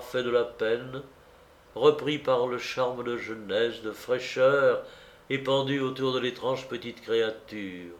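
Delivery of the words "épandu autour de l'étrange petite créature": ordinary delivery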